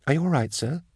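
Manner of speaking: put-on high, soft girl's voice; soft-voiced concerned query; concerned young clerk. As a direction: ask gently, pitched well up. Box-office girl asks with concern.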